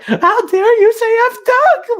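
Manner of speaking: loud, very high, slow falsetto; mock outrage. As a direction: parody a triggered online critic. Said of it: "tearing their brains because they were like".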